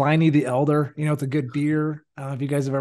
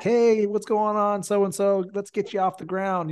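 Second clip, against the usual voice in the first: higher-pitched, cheery helper voice; joyful; 7 semitones higher than usual. Retold or imitated. Responder helping a drunk man up, imitated joyful greeting. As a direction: imitate jolly encouragement to someone on the ground. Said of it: "joyful, Rolfie, like".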